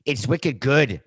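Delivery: a light, hammed-up Boston accent on wicked good. Podcaster tries a Boston accent like Mark Wahlberg, lightly done. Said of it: "trying to have a Boston accent".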